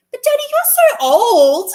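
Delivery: put-on high, loud, laughing kid's voice "you're so old"; child teasing, laughing. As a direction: mimic a cheeky little boy mocking his old dad. Son teasing his dad.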